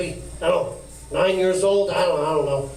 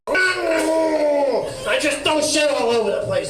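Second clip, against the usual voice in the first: higher, slow shouted Trump rant; Donald Trump; 8 semitones higher, much slower than usual. Shown as a demonstration. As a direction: yell it out like Trump on stage. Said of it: "I do a good Donald Trump".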